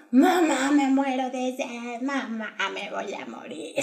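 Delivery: fading, dramatic whine to mom; mock whining child. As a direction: ham up a thirsty kid begging. Imita a la hermana pidiendo agua. (quejido fingido de niña, se apaga)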